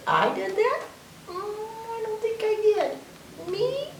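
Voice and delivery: high-pitched, questioning denial; feigned innocence. Acted. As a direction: play innocent, as if surprised to be blamed. Cow feigns innocence, pointing at himself.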